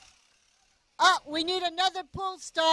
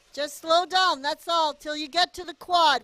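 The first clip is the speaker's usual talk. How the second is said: normal talking voice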